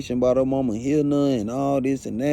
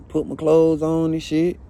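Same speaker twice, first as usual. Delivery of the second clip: ordinary talk, nothing marked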